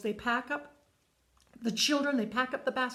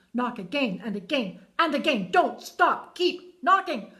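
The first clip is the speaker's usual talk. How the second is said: urgent command; 2 semitones higher, 6 dB louder than usual. Acted. God urging Carol to keep knocking, building to a loud command. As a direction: push harder with each order until it's a call.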